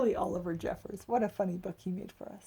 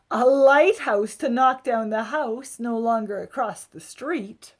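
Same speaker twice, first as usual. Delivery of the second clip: expressive read-aloud storytelling; picture book narration; 3 semitones higher, 15 dB louder, slower than usual. A narrator's reading. Adult reading a picture book to children, lively storybook voice. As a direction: tell it like a bedtime story for kids.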